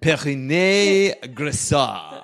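slow, careful French accent. Shown as a demonstration. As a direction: roll it out in French. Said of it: "Say it in a French accent".

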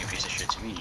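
whispering. Real, not acted. Muttered aside during insults.

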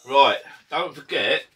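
big loud slow call to viewers; loud slow announcement. Real, not acted. Vlogger grabbing viewers' attention, loud and slow. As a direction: boom it out to the camera.

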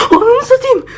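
high, strained shocked swear; high frightened oath; terrified curse. Real, not acted. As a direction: blurt it out in fright. Real-life scare, TV turned off.

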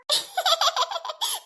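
high, quick giggling; amused giggle. Acted. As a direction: giggle helplessly at a stunned friend. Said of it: "എനിക്ക് ചിരി വരുവാ". (അടക്കാനാവാത്ത കിളിക്കൊഞ്ചൽ ചിരി)

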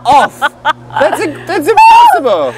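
high loud laughing disbelief. Real, not acted. Laughing at a close plane.

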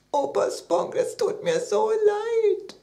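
slow wail rising very high; crying apology. A narrator's reading. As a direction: sob out an acted, heartfelt apology. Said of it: "die Tränen laufen und liefen". (weinend und heulend sagen)

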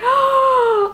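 sharp high frightened gasp; real gasp of fright at a spider; startled gasp. Real, not acted. Spider touches her finger.

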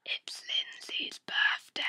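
breathy secret reveal; hushed reveal; whisper. Pretend.

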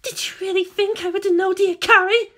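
high, intense woman's voice; confrontational. A narrator's reading. Captive woman turning on her kidnapper, confrontational. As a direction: challenge him in a high voice. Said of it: "Maya whispered to his ear".

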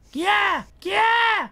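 high, loud yell of alarm; panicked shout. Real, not acted. Scared gamer yelling at a creepy phone call, panicked shout.

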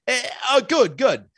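put-on loud, high Philadelphia accent greeting; hammed-up Philly "oh, good, good"; a jokey Philadelphia accent, pitched high and loud. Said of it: "If I do my Philadelphia accent".

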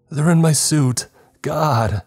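very high, loud screaming; terrified scream. A narrator's reading. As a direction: scream in pure terror. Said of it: "James shouted".

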